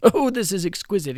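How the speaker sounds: loud, high thrilled exclamation; exclaimed. Acted. The king exclaims over the orangutan plan, loud and high. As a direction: gush with glee. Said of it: "exclaimed the king".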